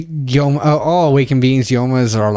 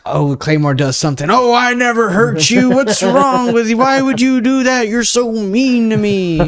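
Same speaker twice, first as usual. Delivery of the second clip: whiny mock victim; 12 semitones higher, 5 dB louder than usual. Mimicking the monster whining to the Claymore. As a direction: play the hurt innocent, overdone. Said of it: "And then, like".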